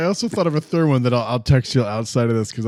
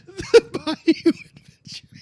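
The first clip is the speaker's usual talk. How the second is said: laughing through the words; giggling, slowed by laughter; slower than usual. Real, not acted. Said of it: "that's way funnier".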